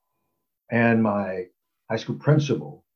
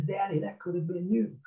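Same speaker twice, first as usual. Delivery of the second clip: put-on young son's voice; 8 semitones higher, 6 dB quieter than usual. Son's words voiced by father.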